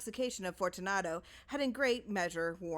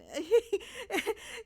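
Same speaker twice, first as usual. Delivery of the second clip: shrill forced laugh; high desperate laugh; 14 semitones higher, 4 dB louder than usual. A narrator's reading. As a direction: laugh in rising panic. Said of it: "a low laugh".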